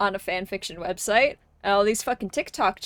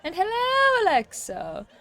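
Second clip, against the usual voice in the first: excited greeting; 9 semitones higher than usual. Real, not acted. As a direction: pitch leaps high on "hello". Greeting a chat viewer.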